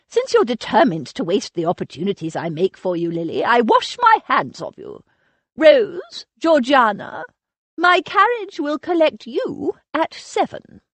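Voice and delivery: put-on imperious elderly aunt, scornful and sharp; a grand dame dismissing the girl's excuse; haughty old aunt. Aunt Agatha washing her hands.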